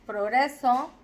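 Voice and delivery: ordinary delivery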